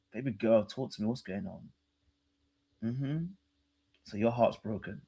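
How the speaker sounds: a hushed, low imitation of a gentle man; soft low mock comfort. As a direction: murmur it softly like a caring guy. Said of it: "He said, he did this".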